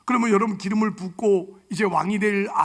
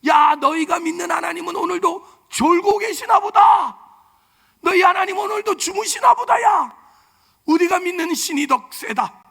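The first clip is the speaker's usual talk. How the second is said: loud, much higher jeering taunt; mocking shout; 8 semitones higher, 5 dB louder than usual. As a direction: sneer at their God, shouting. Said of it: "종교적 조롱까지 하잖아". (하나님을 조롱하며 외치는 골리앗)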